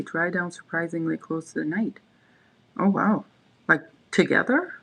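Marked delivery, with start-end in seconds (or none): none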